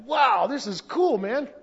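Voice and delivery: high, loud, drawn-out wow; amazed excitement. Retold or imitated. Preacher recalls his delight at a man's conversion, amazed. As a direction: marvel out loud, stretching the words.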